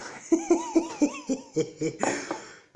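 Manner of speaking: very high burst of laughter; a squeal of delight, falling away. Real, not acted. Laughing at a unicorn mug.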